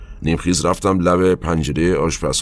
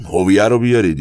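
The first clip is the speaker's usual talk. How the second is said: calling out the order, raised and louder; a captor yelling for the soldering iron, mildly raised shout; retold shout; 4 semitones higher, 5 dB louder than usual. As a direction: bark the order so the whole house hears. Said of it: "جواد داد زد".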